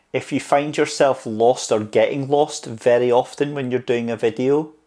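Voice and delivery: ordinary talk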